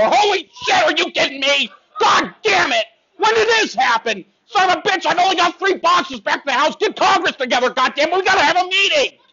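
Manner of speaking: high, frantic panicked yelling; mock panic. Comic playing a panicked citizen over lost Twinkies, shrill yelling. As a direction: shriek like the world is ending.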